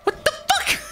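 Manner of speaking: very high, exasperated squeal breaking into laughter; laughing disbelief. Real, not acted. Streamer catching another slip, laughing exasperation. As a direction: squeak in amused outrage at yourself.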